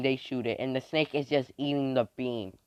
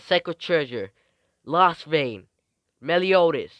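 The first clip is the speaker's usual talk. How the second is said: loud, raised battle call; acted call-out; 5 semitones higher, 9 dB louder, slower than usual. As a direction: shout the weapon's name to its owner. Calling out a weapon to its owner.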